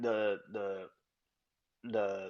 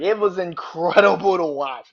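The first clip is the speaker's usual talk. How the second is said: excited; 6 semitones higher, 10 dB louder than usual. Real, not acted. Hyped about segment.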